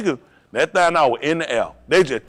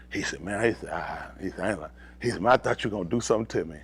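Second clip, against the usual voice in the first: much lower and softer, almost under the voice; low confiding; 8 semitones lower, 10 dB quieter, faster than usual. Retold or imitated. Retelling what the man confided to him, low confiding. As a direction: say it low and quietly. Retold quiet confiding quote.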